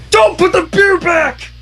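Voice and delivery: high, slow desperate shout. A narrator's reading. Teacher yells at cashier not to return beer, shouting. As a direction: cry out to save the beer. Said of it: "Cheerilee shouted".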